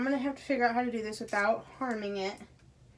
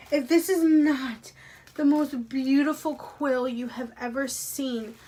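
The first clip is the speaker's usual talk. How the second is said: thrilled gush; 2 semitones higher, 7 dB louder than usual. Real, not acted. Raving about the quill.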